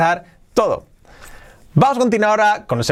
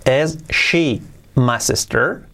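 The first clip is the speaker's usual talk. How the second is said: slow, separated-word question; careful slow dictation, rising at the end; slow model; 2 semitones lower, slower than usual. Shown as a demonstration. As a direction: ask it word by word for learners. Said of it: "luego un poquito más rápido".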